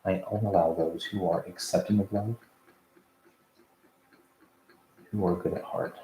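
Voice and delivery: quiet, low prayer voice. Real, not acted. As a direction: softly ask the archangels for protection. Prayer of protection before session.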